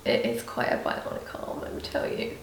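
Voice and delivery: whispered wry aside; whispering. Real, not acted. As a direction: breathe it as a secret. Wry aside about his arm.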